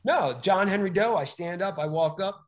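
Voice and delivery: normal talking voice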